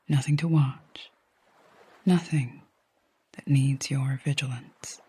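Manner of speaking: calm meditation voice with long pauses; guided sleep hypnosis. Acted. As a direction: guide them gently to sleep, leaving long silences between phrases.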